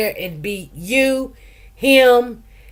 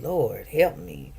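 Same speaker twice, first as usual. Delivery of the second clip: soft, slow murmured prayer on "Lord, help me."; head down, breathe out "Lord, help me." softly and slowly; quiet prayer; 7 semitones lower, 10 dB quieter, slower than usual. Retold or imitated. Head bowed before the judge, a soft slow prayer. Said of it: "I put my head down and I just said".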